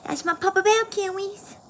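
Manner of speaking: put-on baby voice. Shown as a demonstration. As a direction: coo it like a little kid. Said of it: "I used to do that in front of my kids".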